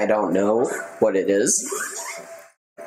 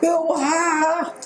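very high hooting creature cry; playful imitation of a creature cry; mock Pokemon cry. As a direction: echo the creature's call up high. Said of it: "I love that".

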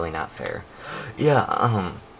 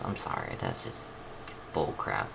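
much lower, softer mutter; 14 semitones lower, 12 dB quieter than usual. Real, not acted. Vlogger ending her rant to viewers, low muttered. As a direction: mutter it under your breath.

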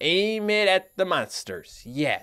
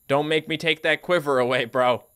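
normal talking voice

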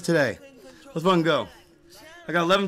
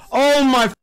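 usual speaking voice

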